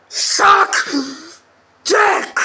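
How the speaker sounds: high, loud furious punch; angry rant. Real, not acted. Complaining about the game controls, angry and loud. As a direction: spit the words out in anger.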